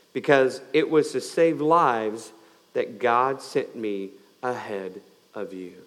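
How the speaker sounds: stretched, deliberate scripture; slow emphatic reading. Acted. Pastor reading Joseph's words to brothers, slow and deliberate. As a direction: linger on each "do not".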